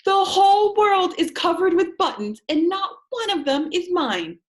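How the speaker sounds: high, loud angry scream. A narrator's reading. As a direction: scream it in a tantrum. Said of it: "jumped up and down and screamed".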